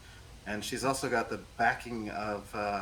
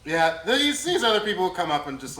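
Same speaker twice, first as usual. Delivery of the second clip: animated, quick and loud; excited; 7 semitones higher, 9 dB louder than usual. Real, not acted. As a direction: rush out the description. Excited description of scene.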